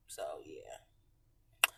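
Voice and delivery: low unvoiced whisper; whispered trailing off. Real, not acted. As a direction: drop to a breathy hush.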